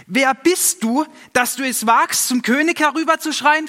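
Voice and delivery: high, loud, indignant shout; angry shout. A narrator's reading. Abner yells up at David from the camp, angry shout. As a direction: yell back, outraged at being roused.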